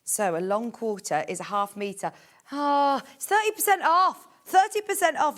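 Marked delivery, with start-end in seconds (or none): 2.5-5.4 higher and louder, thrilled to announce it; excited discount call. Real, not acted. Host telling viewers about a surprise discount, excited. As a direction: sell the bigger discount with real delight.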